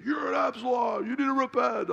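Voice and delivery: high, shouted denunciation; Raul Reese. Retold or imitated. Fiery pastor publicly calling out a rival on the radio, high accusing shout. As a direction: call him out at the top of your range. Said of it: "he was calling John Wimber out".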